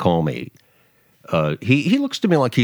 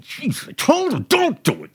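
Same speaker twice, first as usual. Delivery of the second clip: mock fretting. Host mocks a Trump hater, with a high whiny fret. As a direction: whine like a fussing critic.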